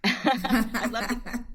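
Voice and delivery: amused, words drawn out by laughter; laughing. Real, not acted. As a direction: laugh your way through the words. Laughing at the Caribbean question.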